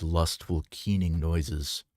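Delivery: ordinary delivery, nothing marked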